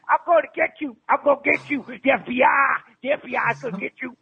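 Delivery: high-pitched, hammed-up vigilante rant; shrieky put-on menace; frantic mock threat. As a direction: pitch it way up and threaten like a cartoon hunter. Prank caller continues mock threats.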